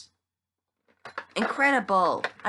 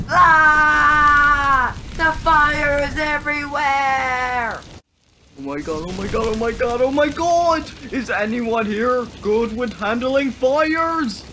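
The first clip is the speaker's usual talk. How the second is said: panicked shouting; 13 semitones higher, 12 dB louder than usual. Acted. Panic as the fire spreads.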